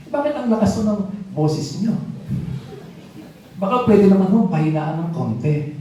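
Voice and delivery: high, mock-courteous request; polite but pointed. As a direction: sweetly ask him to tone it down, with an edge. Ate Bel asks minister to lower voice.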